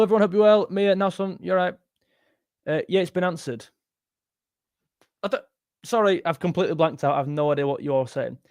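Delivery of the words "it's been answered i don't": normal talking voice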